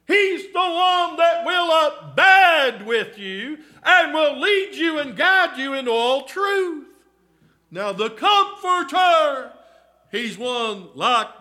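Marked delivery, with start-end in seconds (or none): 0.1-9.5 loud, high, rising preacher's cry to the flock; full-force preaching. Acted. Preacher calling to the congregation about hope, full-force preaching. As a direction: preach it out, pleading and building.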